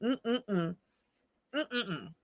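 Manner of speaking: soft closed-mouth hum, near tears; choked-up humming. Real, not acted. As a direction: hum through the lump in the throat. Chokes up thinking of father.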